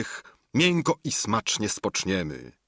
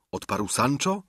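usual speaking voice

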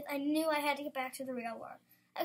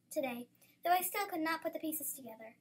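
usual speaking voice

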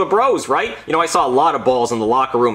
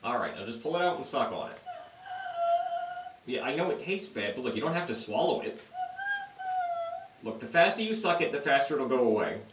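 soft coaxing; 5 semitones higher, 13 dB quieter than usual. Acted. Coaxing patient to suck cough drop.

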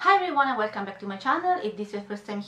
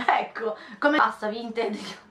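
usual speaking voice

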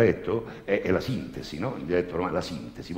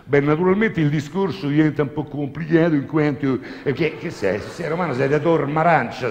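higher, louder affected professor; posh professor voice; 8 semitones higher, 5 dB louder than usual. Retold or imitated. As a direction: put on a stuffy academic voice.

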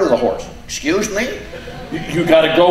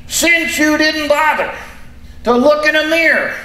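high, squeaky puppet voice; squeaky ventriloquist dummy; 7 semitones higher than usual. Acted.

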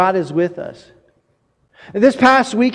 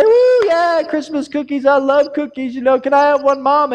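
put-on higher child's voice, eager about cookies; child begging mom for Christmas cookies, higher and eager; excited child; 4 semitones higher than usual. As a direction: squeal like a kid begging for cookies.

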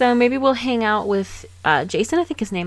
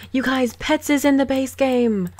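playful fake excitement, pitched up; mock-excited announcement; 5 semitones higher than usual. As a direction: announce it like huge news, jokingly. Joke announcement, then says kidding.

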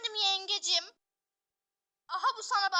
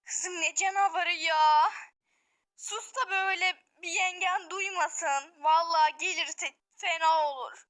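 usual speaking voice

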